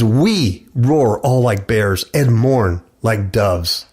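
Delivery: very slow, weighty verse; slow scripture reading. Acted. Preacher rereads Isaiah slowly. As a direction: stretch the verse out.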